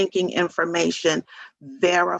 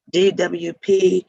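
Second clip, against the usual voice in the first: ordinary talk, nothing marked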